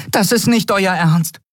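a tiny creature flapping in alarm; small excited Nelen. A narrator's reading. Nelen objects to Keru in a high, hurried voice.

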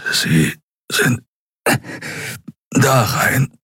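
a battered admiral straining to speak; weak injured admiral. A narrator's reading. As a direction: push the words out through pain, lightly voiced. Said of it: "deutete er".

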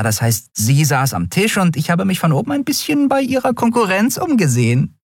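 high-pitched little rascal, hedging; sheepishly proud Nelen. Acted. Nelen admits spying on card players, high and coy. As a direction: own up coyly in a high voice.

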